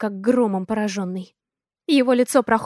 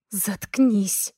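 through gritted teeth. A narrator's reading. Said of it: "Цежу я сквозь зубы".

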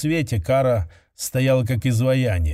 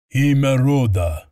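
slow, booming ritual call, a touch louder; low booming judge; 4 dB louder than usual. Acted. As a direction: boom it like an ancient judge. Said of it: "произнёс судья низким гулким голосом".

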